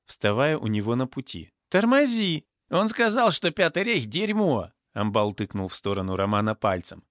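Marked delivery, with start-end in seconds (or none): 1.7-4.7 high-pitched, heated outburst; acted agitated shout. A narrator's reading. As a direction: cry out high in the heat of a quarrel. Brawl breaking out, shouting. (возбуждённый крик в ссоре)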